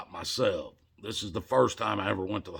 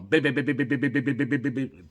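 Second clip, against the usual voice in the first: rapid high-pitched string of beeps; high staccato machine beeping, nonstop; racing monitor beeps; 5 semitones higher, 3 dB louder than usual. Retold or imitated. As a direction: imitate a heart monitor going frantic. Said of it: "I got a monitor up above me going".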